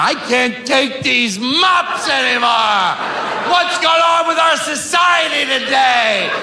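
high, slow exasperated rant; mock fed-up customer. Comedian mocking an imagined mop hater, high and slow. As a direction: complain dramatically about mops. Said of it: "Who's walking around".

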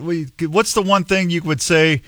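ordinary delivery

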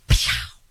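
breathy dismissive scoff; scoffing pshaw. Pretend. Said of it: "do my thing here".

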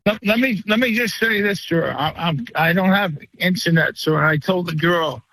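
usual speaking voice

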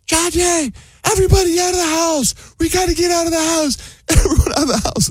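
very high, shrieky imitation yell without real volume; mock yelling. Husband imitating wife ordering everyone out, high mock yell. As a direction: imitate a panicked yell up in falsetto. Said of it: "she yells".

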